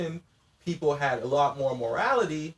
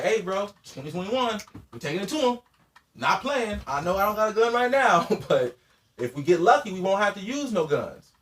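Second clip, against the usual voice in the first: rallying call; 7 semitones higher, 5 dB louder than usual. Retold or imitated.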